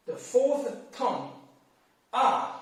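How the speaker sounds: R falling sharply from high; fourth tone R. Shown as a demonstration. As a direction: snap R straight down. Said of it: "Let me take R as an example".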